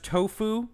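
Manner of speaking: "Tofu" as two syllables, toe plus foo; pronounced "toe-foo". Shown as a demonstration. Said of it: "I'm gonna guess is pronounced".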